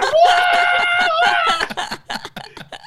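high shrieking burst of laughter. Retold or imitated. Stepmother cracking up at the mix-up, shrieking laugh. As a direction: howl with laughter.

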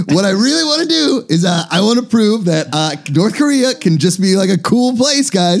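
Kim Jong-un. Acted. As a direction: pitch your country as the cool place. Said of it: "Kim Jong-un is like".

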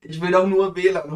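normal talking voice

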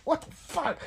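quick high-pitched squeal; break from regular voice. Retold or imitated. Showing a buddy's outburst mid-story, squeaky. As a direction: leap suddenly far above the storytelling voice. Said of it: "would tell a regular story at regular voice".

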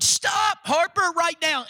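high, pleading shout of stop; mock shout. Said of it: "we're gonna have to try a different approach".

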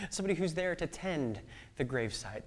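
ordinary talk, nothing marked

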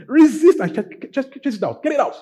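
high, loud, urgent exhortation; forceful command. Real, not acted. Preacher telling flock to chase sickness out, urgent high command. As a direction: drive it out with your voice. Urging them to expel sickness.

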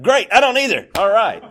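loud, high, laughing retort; retold gleeful outburst, breaking into laughter; exuberant relief. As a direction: burst out with mock-triumphant agreement.

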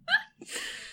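a squeaky high laugh; high squeal of laughter. Real, not acted. Laughter burst.

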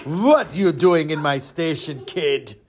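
put-on slow, accented big-man voice; heavy put-on giant's voice; huge gruff man. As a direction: loom over the kid, slowly. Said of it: "three hundred pound, seven-foot man".

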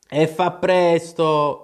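insistent storybook Hatter; urging Hatter. A narrator's reading. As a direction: press the sleeper, drawing the words out. Hatter urges the Dormouse on.

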